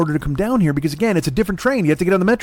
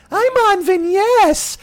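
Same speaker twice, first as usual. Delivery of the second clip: much higher, slow squeaky whine; mock squeaky voice; 12 semitones higher, much slower than usual. Mocking his friend's text, slow squeaky high whine. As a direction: squeak it out like a silly kid.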